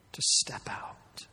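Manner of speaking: breathy slow whisper, as if into the tomb. Acted. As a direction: whisper it gently and slowly. Jesus' words spoken at the tomb.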